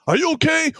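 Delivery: high-pitched imitated call; calling up the stairs. Blake checks on him from downstairs, high call. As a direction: call it up high to someone upstairs.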